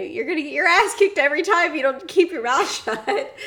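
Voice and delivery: high, loud scolding breaking into laughter; mock scolding. Retelling a film hero warning a mouthy kid, mock scolding.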